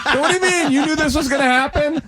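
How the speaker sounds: mock incredulous whine. Protesting to AA members.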